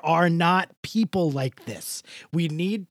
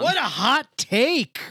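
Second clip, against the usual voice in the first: mock awe; 9 semitones higher, 5 dB louder, slower than usual. Teasing about the answer.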